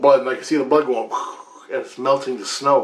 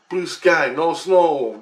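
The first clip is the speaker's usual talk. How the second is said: normal talking voice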